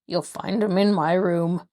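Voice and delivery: usual speaking voice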